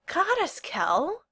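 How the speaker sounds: high-pitched awe; awed. A narrator's reading. Owen reacts to Kel's love life, awed. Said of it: "Owen said, awed".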